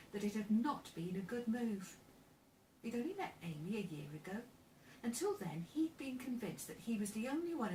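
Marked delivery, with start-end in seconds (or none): none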